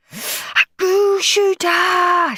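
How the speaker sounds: high, stretched-out thrilled cry; delighted shout. Acted. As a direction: shout for joy at the gift. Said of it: "shouted horrid Henry".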